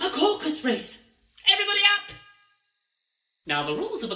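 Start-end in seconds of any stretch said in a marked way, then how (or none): 0.1-2.2 excited. Acted. Excited idea for a race.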